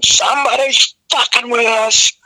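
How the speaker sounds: high-pitched reenacted rant; yelling. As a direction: yell it like an outraged singer to a huge crowd. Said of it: "and I'm yelling".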